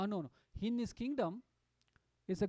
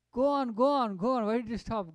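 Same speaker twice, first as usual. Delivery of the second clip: loud, higher, excited insistence; eager urging; 4 semitones higher, 10 dB louder than usual. Retold or imitated. Mahaprabhu urging the reciting king to continue, loud and eager. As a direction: urge him on, thrilled he stopped.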